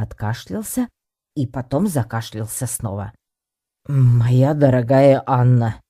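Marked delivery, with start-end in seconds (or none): 3.9-5.8 slow, loud, ceremonial address; solemn Werner. A narrator's reading. Professor opening his proposal to Anna, solemnly. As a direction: declaim it grandly, drawing out each word. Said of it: "начал он торжественно".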